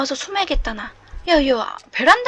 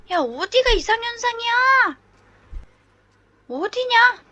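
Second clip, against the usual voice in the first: ordinary talk, nothing marked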